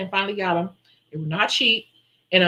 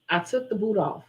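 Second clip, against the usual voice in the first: normal talking voice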